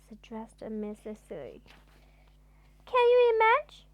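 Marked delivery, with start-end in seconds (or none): 2.8-3.9 high, loud disbelieving question; incredulous. Acted. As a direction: ask it in loud amazement. Narrator's incredulous aside.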